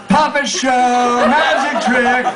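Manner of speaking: high, drawn-out squeal. A narrator's reading. Woman smacking passengers with her string, high squeal. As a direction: squeal it high, stretching the words. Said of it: "the whole time squealing".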